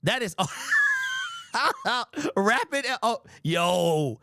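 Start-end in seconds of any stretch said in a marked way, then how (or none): 0.4-2.3 very high, shrieking burst of laughter; screaming laugh. Real, not acted. Reactor shrieks with laughter at the buzzwords. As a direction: let out a wild cackle.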